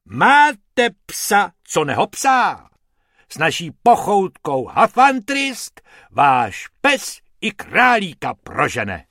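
loud, high commercial read; puffed-up advert voice; pompous hippo ad announcer; ad pitch. A narrator's reading. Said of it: "nafoukl ... a spustil".

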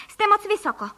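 high-pitched motherly warning; worried scolding. A narrator's reading. Mother warning children flying too high, worried scolding.

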